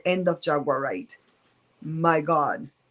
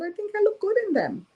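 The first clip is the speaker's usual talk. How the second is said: very high-pitched, put-on self-quote; playful self-quote. Answering godmother about the tops, playful self-quote. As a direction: quote your own reply in a squeaky-high voice.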